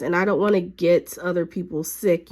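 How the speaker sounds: normal talking voice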